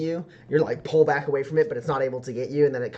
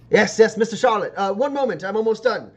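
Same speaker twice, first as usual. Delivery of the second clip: loud, high-pitched character voice; busy, excitable character; 8 semitones higher, 5 dB louder than usual. Acted. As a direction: fend off a visitor mid-task, pitched high. Granger answers the visitor.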